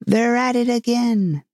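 mock shout. A narrator's reading. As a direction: call it out high, as a restrained shout. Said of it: "shouted at the top of his voice".

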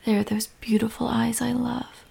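soft, breathy adoration; hushed and loving; tender affection. Acted. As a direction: murmur it lovingly, close up. Tender words to upset partner.

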